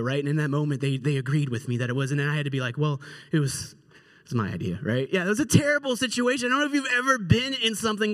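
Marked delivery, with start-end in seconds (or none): 2.8-4.8 low, hesitant confession; acted halting mumble of guilt; sheepish admission. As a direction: reluctantly own up, pitch sinking. Sheepishly owns up to idea.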